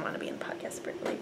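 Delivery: fast whispered aside; whispering. Real, not acted. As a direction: whisper it quickly. Whispered aside about the cat.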